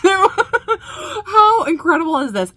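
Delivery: high, slow, breaking into a laugh; amazed, half-laughing. Real, not acted. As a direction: let the amazement spill into laughter. Amazed reaction to the performance.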